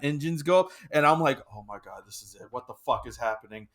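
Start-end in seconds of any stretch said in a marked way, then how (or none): 1.5-3.7 soft, lower retold panic; hushed aside. Passenger telling himself the plane is going down, hushed. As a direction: say it under your breath, much softer. Retelling his own panicked thought.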